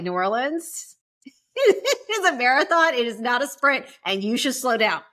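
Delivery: laughing, slowed mock-wise friend voice; a slow, amused imitation of a friend's warning; friend's advice. Friend warning about New Orleans, mock-wise and laughing. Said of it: "he was like".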